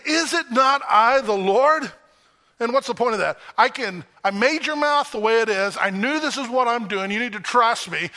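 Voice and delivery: impatient God. Retold or imitated. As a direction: press Moses hard with each question. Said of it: "somewhat impatiently".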